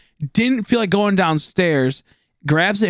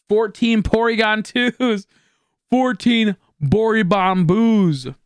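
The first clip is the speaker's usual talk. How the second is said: slow drawn-out; 6 semitones higher, much slower than usual. Real, not acted. Silly slow exclaim.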